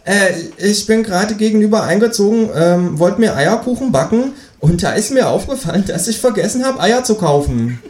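high-pitched kangaroo neighbour; kangaroo character. Acted. Kangaroo asks the new neighbour for eggs, high-voiced. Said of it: "sagt das Känguru".

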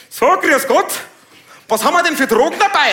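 viel höher, laut, jovial; bayerischer Polizist. Retold or imitated. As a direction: spiel den Landpolizisten bei der Kontrolle. Bayerischer Polizist bei Kontrolle. (Polizist kontrolliert jovial, hoch und laut)

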